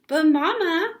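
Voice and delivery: protesting. A narrator's reading. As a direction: whine the objection. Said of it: "protested Ramona".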